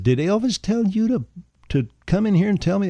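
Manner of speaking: incredulous, high-pitched retort; indignant challenge. Retold or imitated. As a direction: retell an affronted comeback, pitched well up. Challenging the man who corrected him.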